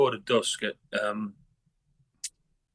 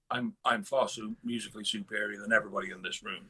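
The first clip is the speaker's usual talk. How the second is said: mock superior; 3 semitones lower, 5 dB quieter than usual. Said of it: "came across as like".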